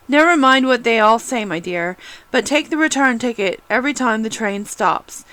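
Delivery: plain reading voice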